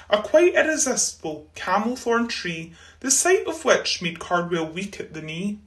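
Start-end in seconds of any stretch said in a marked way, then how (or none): none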